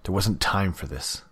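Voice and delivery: usual speaking voice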